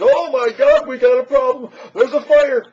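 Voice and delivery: mock panic, shouted high and loud; hammed-up alarm, loud and high; panicked alarm. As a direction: yell it like the building's burning. Mocking panicked officials hearing threats.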